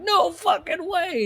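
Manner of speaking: very high, drawn-out mock shock; a high, stretched imitation of being floored; retold disbelief. Retold own shock about Wimmers.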